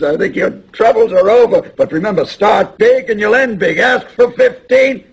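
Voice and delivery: loud, high pep talk; fired-up urging. Acted. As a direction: drive the advice home with rising fervour. Father urging son to aim big.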